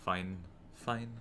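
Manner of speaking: normal talking voice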